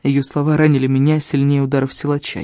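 plain reading voice

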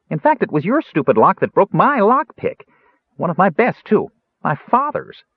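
high, lively, aggrieved prattle; chatty, indignant Kender. Acted. Kender blaming the lock on his jailer, indignant. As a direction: prattle high, defending yourself.

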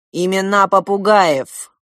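loud, slow, stern demand; menacing demand. A narrator's reading. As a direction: demand it like a threatening ruler. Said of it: "грозным голосом потребовал принц". (грозным голосом)

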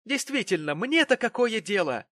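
high, loud sarcastic retort; malicious, mocking outburst; spiteful. A narrator's reading. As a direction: sneer it back angrily. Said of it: "злобно усмехаясь".

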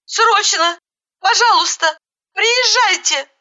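high-pitched urgent pleading; frantic yelling. A narrator's reading. Woman begging the ambulance to come, frantic. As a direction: plead in short, very high cries. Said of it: "завопила, что было мочи".

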